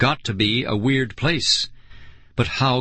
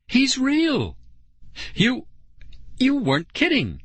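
high-pitched, stunned outburst; shocked and halting, voice way up; shocked scream; 13 semitones higher than usual. A narrator's reading. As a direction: gasp it out high, in disbelief. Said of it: "let out another scream".

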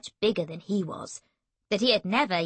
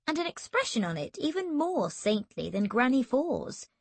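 ordinary delivery, nothing marked

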